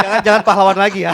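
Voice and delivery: fast, loud, high laughing plea. Real, not acted. Father laughing to the host not to call him a hero, quick and loud. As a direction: beg through a laugh, rushing.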